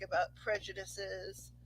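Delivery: ordinary talk, nothing marked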